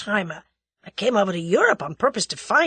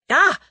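high, loud yelp of pain; as if grazed by a bullet, yelp; sudden pained ah; sharp cry of pain; 11 semitones higher, 9 dB louder than usual. A narrator's reading. Struck by a bullet.

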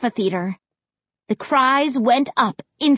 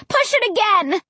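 high, insistent command; pitched-up desperate call; urgent cry; 8 semitones higher than usual. A narrator's reading. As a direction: urge a flagging partner on. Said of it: "I cried".

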